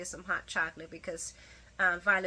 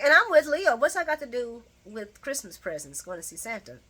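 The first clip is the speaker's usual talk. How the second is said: usual speaking voice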